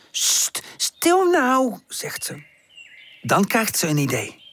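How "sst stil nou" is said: hushing. A narrator's reading. Pip hushing giggling friends.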